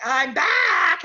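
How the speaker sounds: high, loud, stretched-out BACK; big playful triumphant return; mock comeback announcement. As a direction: announce a grand comeback, hammed-up.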